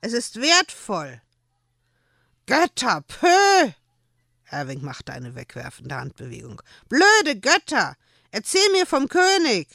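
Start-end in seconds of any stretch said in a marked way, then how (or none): 0.0-1.2 higher, louder indignant reproach; angry. A narrator's reading. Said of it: "Joel war nun auch wütend". 2.4-3.7 high, loud dismissive scoff; boy scoffing at the gods; scornful child. A narrator's reading. 6.9-9.7 high, loud stamping tantrum; boy yelling in a tantrum; furious child. A narrator's reading.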